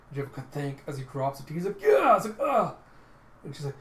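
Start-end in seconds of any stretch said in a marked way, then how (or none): 1.8-2.1 very high, loud yelp; hammed-up stabbed yell; mock scream of pain. Stabbed character cries out in story. 2.4-2.8 high, loud hurt yell; acted agonized yelp; mock cry of pain. Stabbed character cries out in story.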